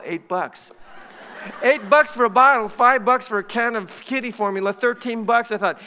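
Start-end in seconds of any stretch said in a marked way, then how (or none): 1.6-2.7 high, loud comic disbelief; outraged repeat. Acted. Shopper reacting to the clerk's bottle price, loud comic outrage. 2.7-5.5 higher, louder tallying of costs; comic outrage. Acted. Shopper totting up the cost of saving a kitten, loud comic outrage.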